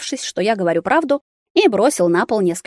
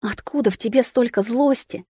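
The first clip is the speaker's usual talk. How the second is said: moaned; 4 semitones higher than usual. A narrator's reading. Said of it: "простонала мама".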